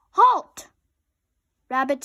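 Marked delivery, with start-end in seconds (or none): 0.1-0.7 high, very loud robotic command; commanding halt. A narrator's reading. Robot commanding the panicking Rabbit to stop. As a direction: stop him dead with one word.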